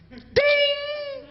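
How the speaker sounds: high ringing ding, drawn out; ding of a bell. Pretend. Comedian tallying points for acts of kindness, ringing ding. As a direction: imitate a scoreboard bell, sustained.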